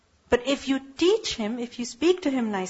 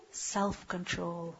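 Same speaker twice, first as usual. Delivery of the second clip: soft answer; 4 semitones lower, 11 dB quieter, much slower than usual. Shown as a demonstration. Answers own question softly.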